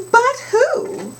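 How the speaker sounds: chorus punchline, high and loud; high but who. Acted.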